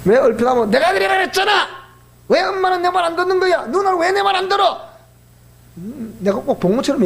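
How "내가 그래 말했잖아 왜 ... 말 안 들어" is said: put-on high, whiny complaining child voice; mock tantrum; imitating angry child. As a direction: whine like a sulking kid. Child voiced whining at family.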